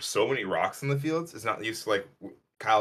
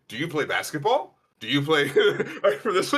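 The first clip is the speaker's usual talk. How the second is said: put-on higher, louder eager kid questions breaking into a laugh; imitating curious kids; 5 semitones higher, 4 dB louder than usual. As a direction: play the excited Greek kids, laughing. Kids asking tall guy questions.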